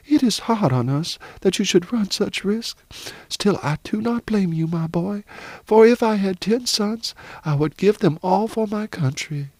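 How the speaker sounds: high, heartfelt mother's voice; proud, earnest mother. Acted. Mother accepts her son's risk for country, earnest.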